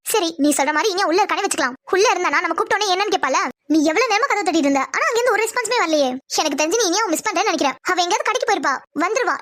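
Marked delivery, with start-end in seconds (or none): none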